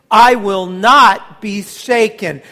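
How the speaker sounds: loud, slow and resolute; declared with the church. Shown as a demonstration. Pastor leading the church in a loud, slow vow. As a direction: lead the congregation in a firm vow. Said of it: "Would you say that with me this morning?".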